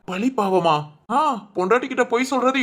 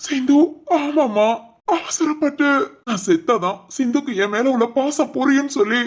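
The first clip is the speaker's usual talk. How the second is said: slightly raised, distressed voice; 3 semitones higher than usual. Acted. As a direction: break grave news with distress. தற்கொலை முயற்சி செய்தி சொல்லுதல். (துயரத்தோடு)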